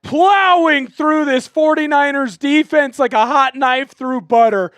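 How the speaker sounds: slow, loud sports call; live play-by-play. Acted. Streamer calling the game for viewers, play-by-play voice. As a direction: call the run like a broadcaster.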